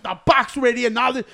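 loud, high snippy coworker voice; annoyed coworker. Retold or imitated. Coworker snapping that he has to wait, loud high snippy. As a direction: snap it out like an irritated fast-food worker. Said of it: "she went like this".

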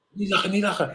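high-pitched mock plea; imitating a panicked hushing teammate; retold urgent warning. Teammates urge him not to laugh.

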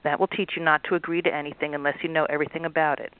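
ordinary talk, nothing marked

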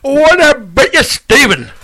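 loud Belfast soap-dad greeting; Jim McDonald impression. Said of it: "do you want Jim McDonald?".

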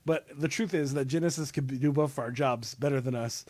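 ordinary talk, nothing marked

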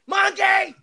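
high, full-volume yell; mock scream. Man yelling from the bedroom at his monkey, mock scream. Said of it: "hear me scream from the bedroom".

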